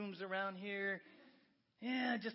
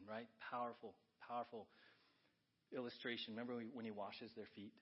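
low, soft, awed storytelling; reverent hush; 9 semitones lower, 10 dB quieter than usual. Acted. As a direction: lean in and tell it gently. Quiet reverent retelling.

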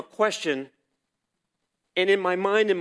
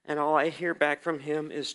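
ordinary talk, nothing marked

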